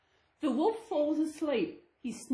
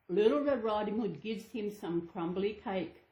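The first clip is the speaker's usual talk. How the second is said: ordinary delivery, nothing marked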